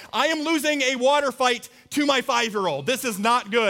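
high, loud, comic dismay; mock alarm. Dad losing a water fight to his son, mock alarm. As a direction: play up the panic of losing.

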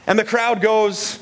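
lightly voiced, stretched-out sports call; sports commentator. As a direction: draw it out a little like a commentator.

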